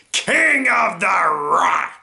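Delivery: loud, boastful character shout; Yao. Acted. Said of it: "I am Yao".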